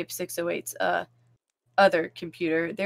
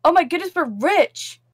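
shrieking jackpot winner; 8 semitones higher, 7 dB louder than usual. Real, not acted. Money jackpot in game.

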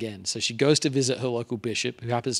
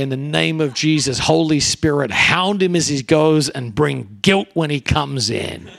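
dad's fervent prayer; 2 semitones higher, 5 dB louder than usual. Retold or imitated. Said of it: "And he'd say".

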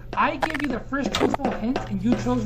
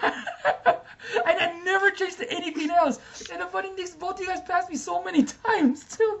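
laughing; 6 semitones higher, 5 dB quieter than usual. Real, not acted. Laughing while gloating.

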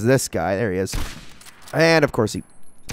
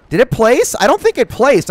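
high, loud sudden confusion; startled and unsure; alarmed doubt; 11 semitones higher, 5 dB louder than usual. Real, not acted. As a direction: blurt it out, pitch jumping up in disbelief. Realises the charge may have failed.

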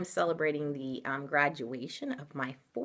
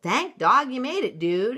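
louder, higher excited exclaim; 7 semitones higher, 7 dB louder than usual. Acted. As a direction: greet him with relief. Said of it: "exclaimed Don Diego".

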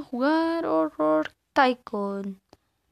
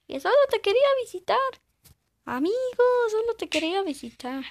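panicked plea; 7 semitones higher, 4 dB louder, faster than usual. Real, not acted. Pleading with attacking player.

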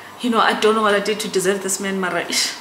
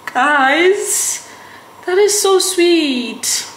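high, drawn-out melting coo; touched, emotional; 10 semitones higher, 3 dB louder, much slower than usual. Real, not acted. Girlfriend telling viewers about his video, touched and emotional. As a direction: gush slowly, as if overcome by sweetness. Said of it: "I'm so emotional".

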